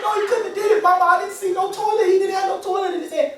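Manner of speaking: put-on high-pitched defensive boy; protesting kid. Son defending Uncle Leroy to mom, protesting.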